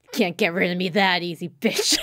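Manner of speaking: mock-menacing taunt; glaring defiance. Evil twin still present despite the glasses, mock menace. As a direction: throw it out defiantly, kicking up on the last word. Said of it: "glares up at Haruka like".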